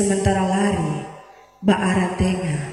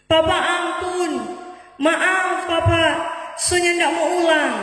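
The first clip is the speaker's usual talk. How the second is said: begging; 7 semitones higher than usual. Retold or imitated. Child begging father forgiveness.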